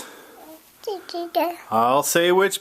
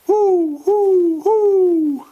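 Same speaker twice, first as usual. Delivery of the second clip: high hoots breaking into laughter; giggly owl impression for the child; 4 dB louder, slower than usual. Shown as a demonstration. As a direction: hoot like an owl while laughing. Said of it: "I'll do the sound".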